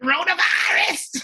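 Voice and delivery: [name] impression. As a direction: belt the catchphrase way up high. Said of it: "Telemundo's using it as a catchphrase".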